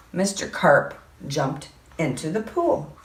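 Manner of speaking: usual speaking voice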